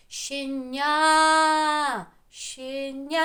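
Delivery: Щеня with НЯ loud, high and drawn out; stressed second syllable. Shown as a demonstration. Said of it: "силою голосу промовимо той склад, який під наголосом". («Щеня» з сильним протяжним наголосом на «ня»)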